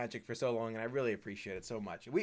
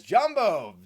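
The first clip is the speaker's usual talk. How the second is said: excited shout; 7 semitones higher, 11 dB louder than usual. Retold or imitated. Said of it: "my Banshee Morpheus says".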